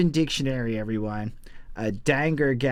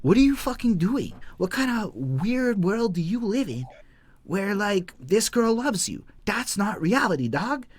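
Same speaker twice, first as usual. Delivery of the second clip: higher-pitched retold scolding; mock incredulous; 6 semitones higher than usual. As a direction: imitate someone setting a fool straight in disbelief. Paraphrasing rapper scolding deluded man.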